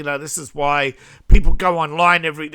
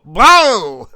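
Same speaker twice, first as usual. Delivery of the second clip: mock jump-scare shout; 5 semitones higher, 12 dB louder than usual. Putin jumping out from under bed.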